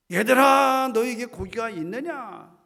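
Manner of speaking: loud call across the water. Acted. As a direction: call to the boat. Jesus calling disciples loudly. (크게 부르듯)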